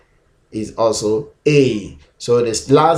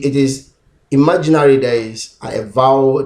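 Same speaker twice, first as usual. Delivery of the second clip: ordinary talk, nothing marked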